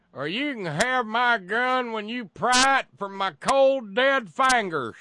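loud, high mocking gun nut. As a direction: shout it like a defiant gun nut. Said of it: "gun goober types who are always saying".

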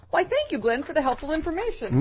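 high, mock-sweet thanks; sarcastic gratitude. Host to absent Glenn Greenwald, sarcastic thanks. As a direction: thank him with exaggerated false sweetness.

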